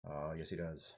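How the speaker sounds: very soft, low mock teacher's accent; murmured imitation of a history teacher's accent; Bob Heaps accent. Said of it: "Bob Heaps".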